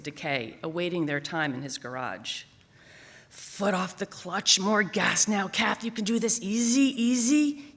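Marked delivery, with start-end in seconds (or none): 3.3-7.7 deep coaxing. Retold or imitated. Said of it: "he coaxed, his deep dad voice".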